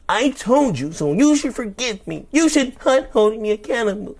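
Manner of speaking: a caricature demanding forgiveness; mock whiny narcissist. Narcissist tells partner to stop blaming, whiny high mockery. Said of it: "this is how it's supposed to go".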